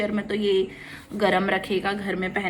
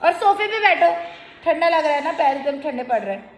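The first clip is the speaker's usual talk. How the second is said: coaxing motherese; 5 semitones higher than usual. Real, not acted. Mother to her cold toddler, coaxing high sing-song.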